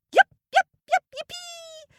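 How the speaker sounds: very high and loud yipping; squeaky chipmunk shout. Acted. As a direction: shriek with joy like a hopping chipmunk. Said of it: "shouted the Chipmunk".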